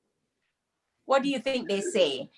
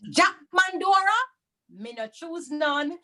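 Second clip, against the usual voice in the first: Jack Mandora called out high and bright; storyteller's closing formula; 7 semitones higher than usual. Acted. [name] ending the Anansi story with a high, ringing call. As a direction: sing out the old Anansi ending.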